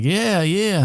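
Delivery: high put-on Australian voice; Australian accent. Woman on the phone answering her date, mock Australian accent. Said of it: "she's using her, uh, Australian accent".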